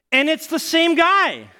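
high, drawn-out comic shout; loud, slow, high-pitched reveal; incredulous punchline. Acted. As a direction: cry it out in amazed disbelief. Climax of mistaken identity story.

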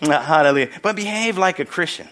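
ordinary talk, nothing marked

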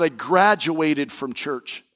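slow, pointed "I graduated from church"; slow deliberate words. Retold or imitated. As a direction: draw it out with a dry edge. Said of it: "this is the way he said it".

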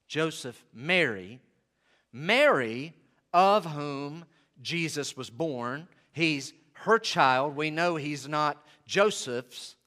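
usual speaking voice

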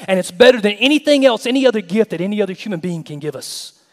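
loud, high, driving sermon delivery; full-force preaching. Acted. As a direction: preach it hard, piling phrase on phrase.

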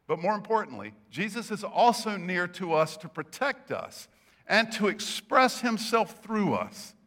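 ordinary talk

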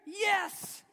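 high, loud, punchy yes; emphatic yes. Real, not acted. Preacher answering an offended mom, emphatic yes. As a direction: slam the answer home.

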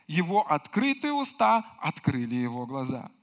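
usual speaking voice

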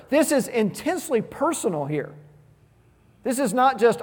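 ordinary delivery

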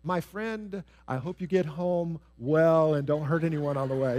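measured, deliberately kindly well-wishing; drawn-out, composed goodwill; wholesome rephrasing. Acted. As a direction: rephrase the outburst as a careful kind wish. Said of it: "just rephrase that and say".

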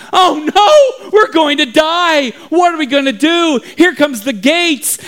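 high, loud mock panic; panicking crowd. Townspeople see the gates coming, panicking. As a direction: wail that all is lost. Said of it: "everyone begins to panic".

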